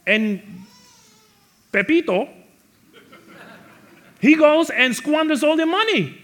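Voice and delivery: high, loud jealous outburst; indignant mock rant. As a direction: rail against the brother's return. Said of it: "He's saying".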